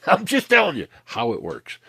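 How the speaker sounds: laughing through the words. Real, not acted. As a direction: chuckle out the line, voice jumping up. Laughs while repeating point.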